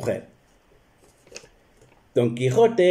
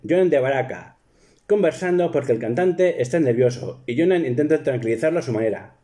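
ordinary delivery

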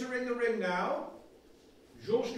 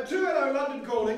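loud call sign; 4 semitones higher, 7 dB louder than usual. Acted.

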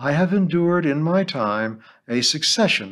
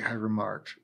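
ordinary delivery, nothing marked

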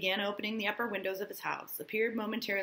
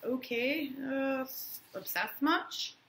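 sarcastic; 6 semitones higher, slower than usual. Retold or imitated. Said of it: "It was kinda like".

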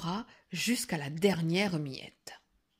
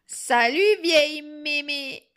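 loud, high cheeky hail; mimicked rude greeting; 7 semitones higher, 8 dB louder than usual. Grandmother imitates the girl's greeting, mock insolence. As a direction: mildly imitate an insolent child.

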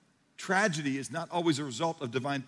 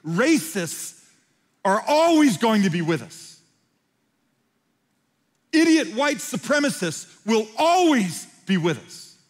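loud, higher sermon delivery; full-force preaching; 4 semitones higher, 5 dB louder than usual. Acted. Pastor confronts the congregation about racism, preaching loudly. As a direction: preach hard and insistent to the room.

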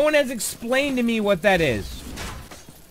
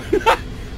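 scream of joy. Real, not acted. Victory after all night.

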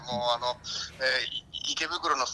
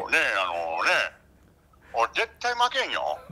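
low, halting Kansai manager impression; 岡田前監督; 9 semitones lower than usual. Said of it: "岡田前監督はいますか？". (岡田前監督のものまねで低くたどたどしく)

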